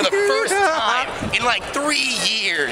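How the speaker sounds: high, drawn-out words for comic effect; dramatic slow reveal. Real, not acted. Telling the camera about the friend, drawn out and high. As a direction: stretch it out like a big announcement. Exaggerated reveal of covered body.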